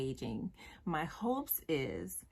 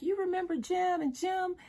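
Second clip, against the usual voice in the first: much higher mom voice; imitating his mother; 12 semitones higher than usual. Mother telling her son about Jim, high voice. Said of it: "his mama came home and was like".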